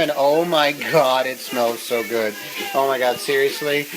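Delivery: normal talking voice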